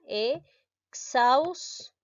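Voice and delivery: exhausto stretched out very slowly, syllable by syllable; syllabified pronunciation. Shown as a demonstration. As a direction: draw exhausto out so each syllable is heard. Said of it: "de esta manera". ("Exhausto" estirado, sílaba por sílaba)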